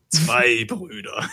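slow, dramatic movie trailer announcer; drawn-out trailer narrator; epic trailer voice. Shown as a demonstration.

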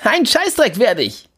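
high, slow defiant retort. Acted. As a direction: spit it back defiantly. Jimmy defiant.

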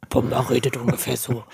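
tiefe Warzenschwein-Stimme; Pumba-Stimme. Retold or imitated. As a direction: wie ein Warzenschwein grunzen. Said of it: "Hast du mal die Stimmen gehört?". (tief grunzende Warzenschwein-Stimme)

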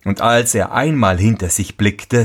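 usual speaking voice

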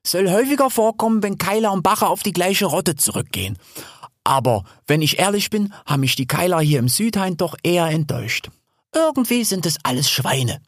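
high-pitched opening, settling into lively chat; chatty wild sow. Acted. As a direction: play Hel, explaining breezily. Hel, chatty boar, explains. (gesprächige Wildsau, anfangs hohe Stimme)

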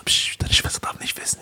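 soft shushing whisper; whispered secret. Retold or imitated. Friend hushes about sister. (flüsternd, verschwörerisch)

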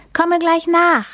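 put-on child calling after dad, a touch loud. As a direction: call it back across the garden. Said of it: "ruft Theo".

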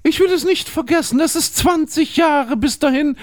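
lightly acted lovers. Lovers' dialogue read aloud.

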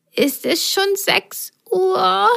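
a snake just woken up; sleepy little snake. Acted. Snake woken by the swinging, high and sleepy. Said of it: "fragte sie verschlafen".

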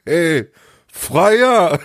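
high, loud jeering shout across the street; loud call. Retold or imitated. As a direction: imitate rowdy lads calling out, voice leaping up. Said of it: "rufen die einfach ganz laut".

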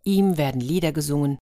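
lively storyteller reading a fairy tale; playful storyteller; story narration. A narrator's reading. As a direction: tell it like a bedtime story to children. Fairy tale read aloud.

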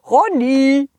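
ordinary delivery, nothing marked

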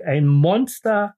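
usual speaking voice

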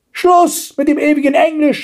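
high-pitched indignant yell; yelling grandmother. A narrator's reading. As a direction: cry out like a cross grandmother. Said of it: "schrie die Großmutter". (schreiende Großmutter, sehr hoch)

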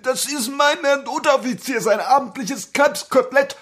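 put-on high, eager young soldier; timid recruit. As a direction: recite it like a nervous recruit. Recruit repeats the answer.

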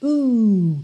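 one held, drawn-out "oo"; long oo sound. Shown as a demonstration. Teacher models the double-O sound, held long. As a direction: stretch the vowel into a long "ooo". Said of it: "Dois Os em inglês é sempre esse uh comprido".

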